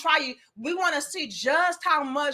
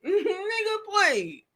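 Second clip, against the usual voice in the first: laughing dismissal; 8 semitones higher, 3 dB quieter, much slower than usual. Acted.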